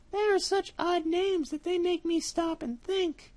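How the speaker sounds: a trusting mouse sending him off; little mouse. Acted. Mouse muses.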